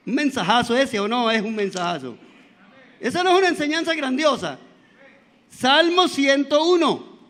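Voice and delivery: ordinary talk, nothing marked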